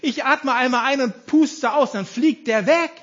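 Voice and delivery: loud, high-pitched scornful boasting; Goliath. Acted. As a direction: scoff loudly at a tiny challenger. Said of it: "diesen Eindruck hatte Goliath sich auch".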